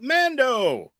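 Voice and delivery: high, drawn-out hail across a courtyard; long, high-pitched impression of a delighted call. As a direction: call his name out like a hearty greeting. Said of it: "Grief Karga calls out".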